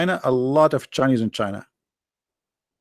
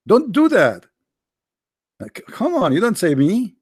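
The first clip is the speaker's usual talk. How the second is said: higher, whiny mock complaint; exasperated plea; 7 semitones higher, slower than usual. Real, not acted. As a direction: pretend to be hurt by the crowd. Scolding the chat about voting.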